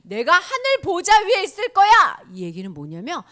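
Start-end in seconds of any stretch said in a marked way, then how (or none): 0.0-2.2 high, very loud declaration; divine proclamation. Acted. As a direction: announce it with full authority. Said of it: "그리고 하나님이".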